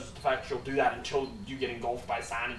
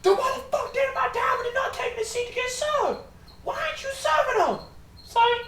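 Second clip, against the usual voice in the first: loud scolding father; 9 dB louder than usual. Acted. Father scolds his daughter Lil about friends, high loud voice. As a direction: bellow at your daughter. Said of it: "She goes".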